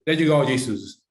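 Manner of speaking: usual speaking voice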